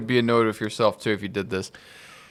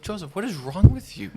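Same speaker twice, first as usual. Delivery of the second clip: mock self-scolding; 5 semitones higher than usual. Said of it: "And I think to myself".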